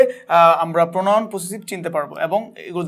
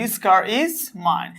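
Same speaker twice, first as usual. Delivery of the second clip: slow example read; much slower than usual. Shown as a demonstration. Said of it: "বলতে পারি".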